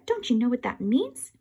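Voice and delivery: ordinary delivery, nothing marked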